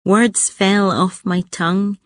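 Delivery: usual speaking voice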